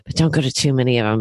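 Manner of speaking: ordinary talk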